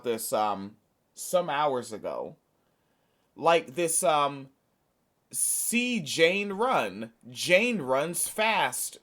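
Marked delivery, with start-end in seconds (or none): 5.3-9.0 slow, simple first-grade reading; See Jane run style. Shown as a demonstration. Mocking the president's backers by reading slowly like a first-grade primer. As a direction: spell it out like a kid's primer. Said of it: "style of speaking".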